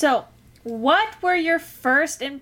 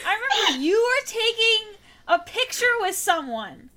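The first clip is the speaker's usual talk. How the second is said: excited laughing; 9 semitones higher than usual. Real, not acted. Sudden memory, laughing.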